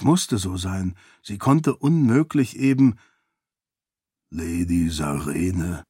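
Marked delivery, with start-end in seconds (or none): 4.3-5.8 low, slow and hushed; Ashe's voice. A narrator's reading. The seon calls softly to the weeping Sarene, low and slow. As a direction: speak her name low and gently. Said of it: "Es war Ashes Stimme".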